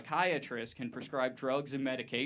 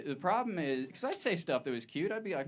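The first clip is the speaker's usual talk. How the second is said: ordinary delivery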